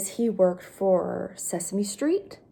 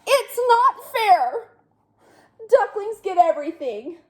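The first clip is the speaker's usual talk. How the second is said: sulky pigeon; 10 semitones higher than usual. Acted. Pigeon whines that ducklings get everything, high. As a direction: whine like a tantrum.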